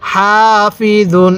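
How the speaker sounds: হাফিজুন stretched out, জ as a soft "z"; soft letter, airy ha. Shown as a demonstration. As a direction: slowly recite হাফিজুন with breathed, long "haa". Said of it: "জটা নরম করে উচ্চারণ করব". (হাফিজুন: হা-তে বাতাস, জ নরম, টেনে পড়া)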